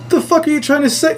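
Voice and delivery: high-pitched baffled demand; retold incredulous challenge. Dreamer questioning fake wife, retold high incredulous voice. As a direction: throw the question up high in disbelief.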